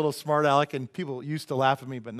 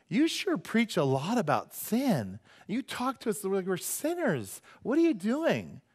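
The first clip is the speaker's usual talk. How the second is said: higher, drawn-out swooping grievance; mock complaint; 6 semitones higher, slower than usual. Churchgoer challenging the pastor about sin, mock complaint. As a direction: imitate an aggrieved churchgoer, pitched up.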